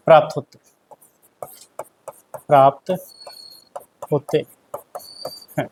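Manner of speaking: teacher reading notes aloud, one word at a time. Acted. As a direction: dictate it to the class so they can write along.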